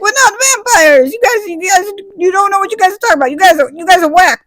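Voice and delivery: very high put-on protest; flustered vampires. Vampires protest to the townspeople, high flustered mock denial.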